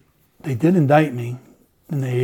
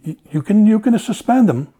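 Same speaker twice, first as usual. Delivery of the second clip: higher and louder, pushing back hard; heated retold argument; 8 semitones higher, 7 dB louder than usual. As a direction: insist over the phone with rising heat. Captain arguing on phone, retold.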